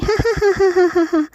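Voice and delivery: high falling granny laugh. Acted. Grandmother laughs with Pinky, high falling laugh. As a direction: laugh along happily. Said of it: "दादी भी हंसने लगी".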